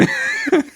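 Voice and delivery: high pitched burst of laughter; a sudden squeaky laugh. Real, not acted. Laughs at own impression.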